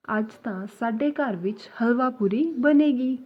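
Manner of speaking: slow, clear Punjabi sentence; slow teaching demo. Shown as a demonstration. Teacher slowly models a Punjabi sentence for learners.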